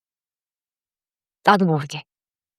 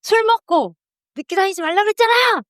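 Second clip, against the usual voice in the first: high-pitched scolding nag; nagging spouse; 11 semitones higher than usual. Acted. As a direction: scold a partner like family.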